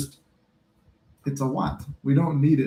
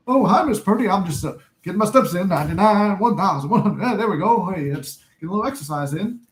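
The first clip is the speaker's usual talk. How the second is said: nervous Sherman; 4 semitones higher than usual. Retold or imitated. Sherman greeting Miss Purdy on the steps, high and nervous. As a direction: act out of breath and awkward on the stairs. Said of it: "he's like".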